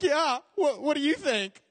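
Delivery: high, sassy retort; sarcastic kid. Retold or imitated. Lost boy sassing the old lady, high sarcastic. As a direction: roll your eyes at the obvious. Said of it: "And I think".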